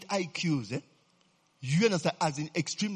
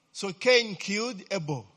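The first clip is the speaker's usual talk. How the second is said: ordinary talk, nothing marked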